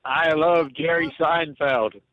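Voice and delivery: put-on very slow old-man American accent. Shown as a demonstration. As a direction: drawl it out like a ninety-year-old American. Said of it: "let's hear [name]".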